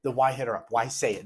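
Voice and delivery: normal talking voice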